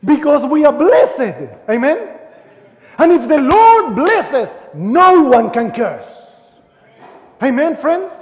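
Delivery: loud, high, joyful preaching; fervent preaching. Acted. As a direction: lift the congregation up. Rising again into full preaching.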